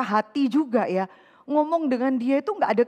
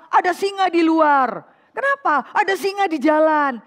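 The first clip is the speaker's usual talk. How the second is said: loud alarmed cry, pitched up; mock alarmed excuse; 4 semitones higher, 6 dB louder than usual. Lazy man's excuse again. (orang malas berteriak panik pura-pura)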